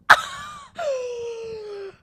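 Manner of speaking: high screaming fangirl laugh; shrieking laugh. Real, not acted. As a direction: let out a delighted scream-laugh. Fan screaming over movie moment.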